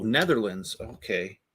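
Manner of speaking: ordinary delivery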